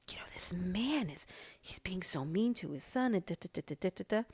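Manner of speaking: hushed whisper to her husband; whispered gossip. Retold or imitated. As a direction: keep it secret from the next table.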